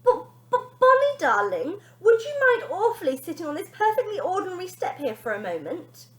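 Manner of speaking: very high, stammering polite ask; timid plea. Acted. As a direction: ask far too nicely. Timid mother asking daughter.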